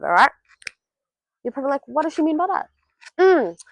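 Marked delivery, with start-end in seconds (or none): none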